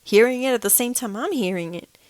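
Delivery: loud, insistent reply; insistent argument. Retold or imitated. Arguing with husband about the pets, loud. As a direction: push back firmly and loud. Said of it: "I'm like".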